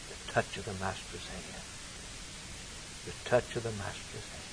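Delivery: low, soft and reverent; hushed answer. Retold or imitated. Preacher reciting the poem, answering softly and reverently. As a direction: let it fall almost to a hush.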